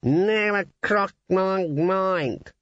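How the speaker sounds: slow words round a mouthful; mouth full. Acted. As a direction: talk through a bite of eyeball. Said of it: "said the raven indistinctly".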